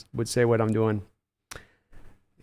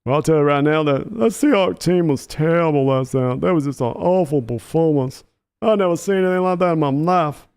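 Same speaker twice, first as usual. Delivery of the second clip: Charles impression. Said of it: "Charles is a real tough one".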